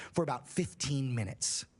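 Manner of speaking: normal talking voice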